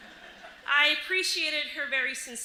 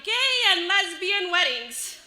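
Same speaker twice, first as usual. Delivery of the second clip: slow, higher, loud read-out; 6 semitones higher, 7 dB louder, slower than usual. Retold or imitated. Boy reading a magazine title aloud, very loudly. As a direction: announce it slowly for the whole store. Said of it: "says very loudly".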